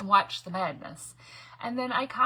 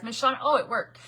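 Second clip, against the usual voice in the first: ordinary talk, nothing marked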